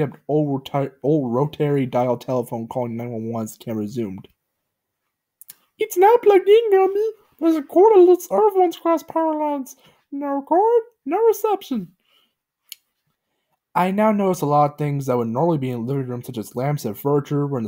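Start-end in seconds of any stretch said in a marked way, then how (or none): 5.8-12.0 very high, loud cartoon voice; squeaky matter-of-fact; Pokey's high voice. A narrator's reading. Pokey tells Gumby the phone is dead, high voice.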